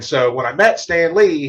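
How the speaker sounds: ordinary delivery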